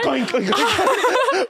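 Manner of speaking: drawn-out, high mock clinks; laughing sound effect of bones on vinyl; slow high clinking noises. Mocking bones hitting the boat.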